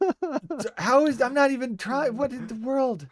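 high-pitched mock disbelief; baffled protest. Streamer retelling his reply to a viewer's accent jab, mock-baffled.

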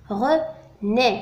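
René split into two syllables, "re", pause, "né"; René pronounced in separated, careful syllables. Shown as a demonstration. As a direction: say René slowly as re... né. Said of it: "نسمع طريقة نطق الكلمة".

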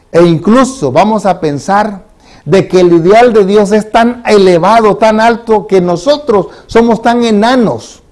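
más agudo, fuerte y lento; predicación enfática. Acted. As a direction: subrayar cada palabra desde el púlpito. Leads a sermon. (predicador enfatiza lo elevado del ideal de Dios)

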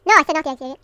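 loud, exasperated repeat; exasperated telling-off; annoyed scolding. Real, not acted. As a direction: snap it out loudly, as if not heard. Scolds, repeating himself louder.

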